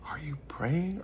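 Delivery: urgent whispered question. Shown as a demonstration. Preacher presses the audience with a whispered question. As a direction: lean in and whisper it.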